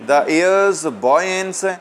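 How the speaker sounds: poem recitation. Real, not acted. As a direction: read the phrase out slowly, lifting the pitch. Reads poem line aloud to students.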